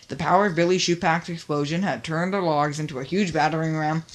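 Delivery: ordinary delivery, nothing marked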